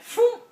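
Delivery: short, high, loud phoom; sudden imitated whoosh; imitated crash whoosh. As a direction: punch out a sound effect for tipping over. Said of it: "and then we went".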